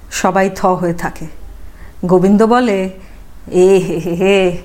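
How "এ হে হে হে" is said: slow, drawn-out dismayed he-he-he; dismayed tut-tut. Acted. Gobinda's dismayed tut-tutting. (হতাশ হয়ে ধীরে টেনে এ হে হে হে)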